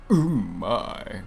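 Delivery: lightly voiced Zarbon; a hint of a preening villain. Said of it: "I do like making fun of Zarbon's voice".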